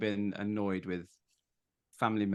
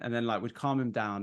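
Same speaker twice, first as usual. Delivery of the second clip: ordinary talk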